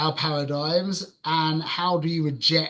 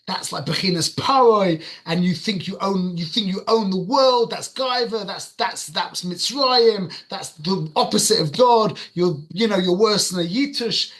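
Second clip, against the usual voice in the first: retelling his own outburst, raised angry scolding; angry tirade; 3 semitones higher, 4 dB louder than usual. Retold or imitated. Telling off a litterer, angry. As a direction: re-enact a righteous, worked-up rant. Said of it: "just really angry".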